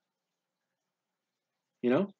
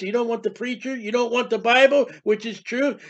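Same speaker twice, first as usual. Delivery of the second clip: ordinary talk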